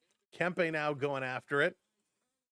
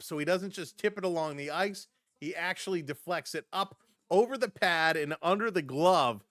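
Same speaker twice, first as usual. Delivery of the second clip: normal talking voice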